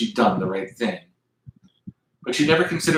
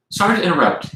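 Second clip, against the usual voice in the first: lightly lilting, higher-pitched polite interjection; 7 semitones higher, faster than usual. A narrator's reading. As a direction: cut in courteously, pitched up. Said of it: "he said politely".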